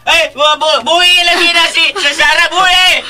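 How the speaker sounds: fast, loud stammering shout; frantic yell. Acted. Mateo yelling at his brother to let Sarah go. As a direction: blurt it out in a panic.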